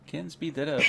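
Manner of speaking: normal talking voice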